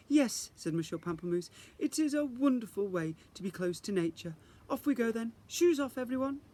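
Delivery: teacher rallying the class; cheerful fairy teacher. Acted. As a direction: announce it with grand enthusiasm. Teacher sends class outdoors.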